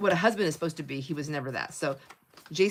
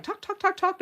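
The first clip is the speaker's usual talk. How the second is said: high-pitched imitation of rapid chatter; mock gabbing, words tumbling out high; excited chatter; 13 semitones higher, 4 dB louder than usual. Friends gabbing by the car, mock excited chatter. Acting out their nonstop chatter.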